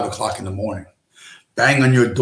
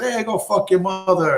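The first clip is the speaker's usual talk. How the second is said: higher, cocky mob comeback; Pesci's cocky retort; 7 semitones higher than usual. Retold or imitated. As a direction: fire back like a cheeky wiseguy. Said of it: "And I said".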